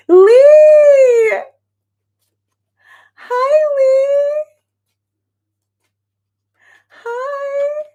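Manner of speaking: very high, drawn-out squeal of delight; overjoyed greeting. Real, not acted. Streamer greeting a dear friend who just arrived, overjoyed squeal. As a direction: squeal hello as if close to happy tears. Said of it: "I feel like I'm about to cry".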